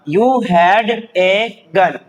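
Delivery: very slow, word by word; drawn-out words, evenly spaced; slow reading drill. Shown as a demonstration. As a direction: model the sentence for beginners, slowly. Teacher models sentence to learners.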